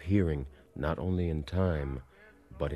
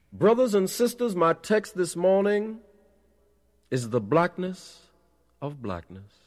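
preacher's shout; 13 semitones higher, 6 dB louder than usual. A narrator's reading. Preacher announcing his text to brothers and sisters, shouted. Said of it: "I heard someone shout".

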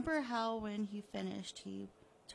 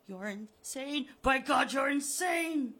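loud and higher, raging; furious shout; 5 semitones higher, 12 dB louder, slower than usual. Acted. Buried Harry raging at Richard, shouting. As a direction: scream it at him.

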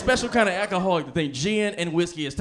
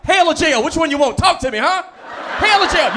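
loud, high angry mom threat; mom threatening; 7 semitones higher, 10 dB louder than usual. Retold or imitated. Mom scolding her daughter in Walmart, loud and high. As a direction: menace like a furious church mom. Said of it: "She was like".

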